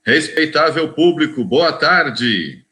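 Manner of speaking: booming circus ringmaster call. Shown as a demonstration. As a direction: announce it big to the whole tent. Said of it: "Tá fraco, tá fraco, tá fraco".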